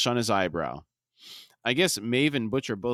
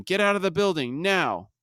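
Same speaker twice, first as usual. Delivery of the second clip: urgent order; 8 semitones higher than usual. Retold or imitated. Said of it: "Butcher again tells him".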